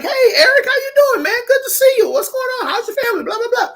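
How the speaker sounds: very high-pitched mock small talk; gushing greeting. Greeting an old classmate at the reunion, high gushing voice. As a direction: sing out a high, friendly hello.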